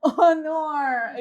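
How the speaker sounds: real laughter; laughing oh no. Real, not acted. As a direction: say it while still cracking up. Laughing at host family.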